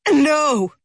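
high, loud desperate cry; shouted no. A narrator's reading. Mother refusing to believe her daughter is dead, desperate shout. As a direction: scream the refusal. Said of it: "shouted Ferris's mother".

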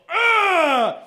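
loud, high shout of exertion; effort grunt. Acted. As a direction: yell out as if smacking a huge drive. Acts out a huge drive.

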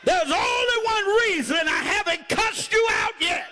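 impassioned preacher driving to a climax; full-force preaching. Real, not acted. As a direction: whip up the congregation with hollered bursts.

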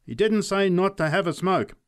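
high, loud heckle; interrupting. A narrator's reading. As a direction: butt in to contradict her. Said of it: "interrupted the drunk".

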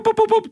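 sing-song electronic boop boop boop; crossing beep imitation. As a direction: mimic the crossing signal's beeps up high. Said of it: "The lights go boop".

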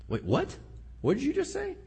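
high, stunned disbelief; incredulous. Pretend. Job reacting to his friend's advice, high stunned disbelief. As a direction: pretend to be floored by the advice.

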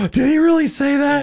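high-pitched gasping disbelief; gasp, then ask it in high astonishment; mock shock. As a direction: play a scandalized kid hearing something racy. Retold giggling kids' shocked reaction.

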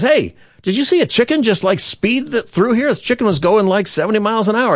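high-pitched, urgent questioning; excited driver. Acted. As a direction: call to a stranger, astonished, pitched well up. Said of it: "and he goes".

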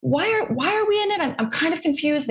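high-pitched mock bewilderment; baffled reaction. Commentator voicing Canadians' first reaction to Eurovision news, baffled. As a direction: imitate a puzzled public, pitched well up. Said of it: "their first reaction across Canada was like".

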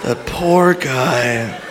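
very slow, subdued lament; slow mock mourning; stunned pity. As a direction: draw it out in hushed mock sympathy. Repeats lament after laughter.

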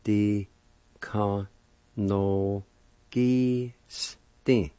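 "de ka no gi stin" in long, separate syllables. Shown as a demonstration. Teacher demonstrating the second part, "de ka no gi stin" syllable by syllable. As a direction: sound out "de ka no gi stin" with gaps. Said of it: "Second part".

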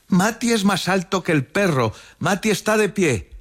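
put-on very high, excited little-kid voice; small child's voice. A narrator's reading. Small child tells Lucas he is standing, high-pitched boast.

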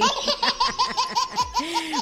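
high-pitched giggle; high laugh. Real, not acted. DJ laughing at his own beer joke, high giggle. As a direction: burst out giggling.

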